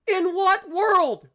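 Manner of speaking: slow, high, loud "world" drawn out; pleading shout at the sky; incredulous shout. Real, not acted. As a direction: stretch "In what world" in a disbelieving yell. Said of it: "This is more disbelief".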